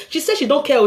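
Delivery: normal talking voice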